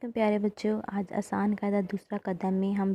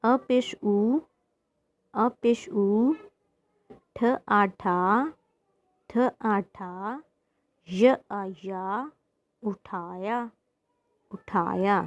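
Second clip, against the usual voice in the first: slow spelling-out; slower than usual. Shown as a demonstration. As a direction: spell each syllable very slowly, letter then vowel mark then sound. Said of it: "इसको पढ़ते हैं". (अक्षर-अक्षर जोड़कर बहुत धीरे पढ़ाना)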